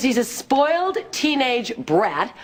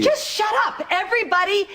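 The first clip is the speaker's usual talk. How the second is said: high, exasperated "shut up"; snapping at everyone; 10 semitones higher than usual. Acted. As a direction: cut them all off, pitched up. Family quarrel, Tracy snaps.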